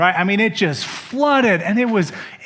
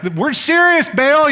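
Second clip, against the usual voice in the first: higher and louder, insisting to their god; mock shout; 9 semitones higher, 5 dB louder, slower than usual. Frantic prophets yelling at Baal, mock shout. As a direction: shout it up at the sky like desperate prophets. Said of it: "They shouted louder".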